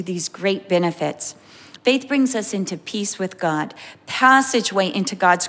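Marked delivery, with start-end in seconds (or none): none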